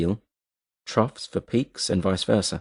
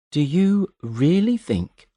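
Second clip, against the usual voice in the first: slow, drawn-out question; slowly; 8 semitones higher, much slower than usual. A narrator's reading. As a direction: let each word hang, very slowly. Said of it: "she said slowly".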